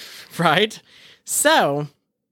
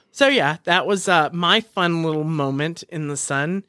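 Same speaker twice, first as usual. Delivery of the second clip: ordinary talk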